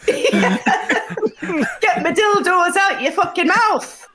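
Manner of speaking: slow, high laughing protest; laughing mock outrage. Presenter laughing back at a co-host, slow high mock outrage.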